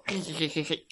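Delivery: soft, rapid mischievous cackle; villain's snicker. A narrator's reading. Alien eats the potatoes, sneaky soft cackle.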